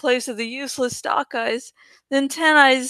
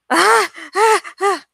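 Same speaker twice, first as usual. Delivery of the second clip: screaming; 6 semitones higher, 3 dB louder than usual. Acted. Woman still terrified.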